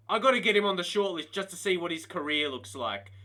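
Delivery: ordinary talk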